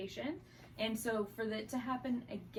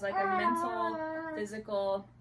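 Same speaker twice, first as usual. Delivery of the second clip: ordinary talk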